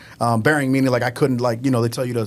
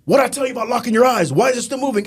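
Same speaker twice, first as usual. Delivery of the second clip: drill instructor scolding; 9 semitones higher, faster than usual. Retold or imitated.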